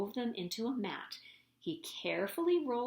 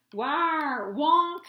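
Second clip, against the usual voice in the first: loud honking creature call; dinosaur call; 5 semitones higher, 7 dB louder than usual. A narrator's reading. George calling out to the trapped Grunts, loud honk. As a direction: trumpet it like a huge dinosaur.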